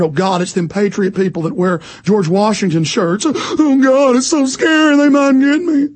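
mock scared soldiers. Host mocking plainclothes soldiers scared of patriots, hammed-up fear. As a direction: whine like a frightened soldier. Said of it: "they actually are scared".

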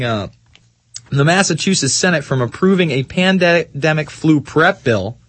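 ordinary talk

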